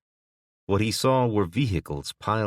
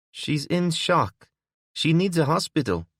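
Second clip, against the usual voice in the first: petite woman's voice; 11 semitones higher than usual. A narrator's reading. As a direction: pitch it up for the petite woman, urgent.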